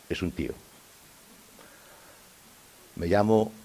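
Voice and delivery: normal talking voice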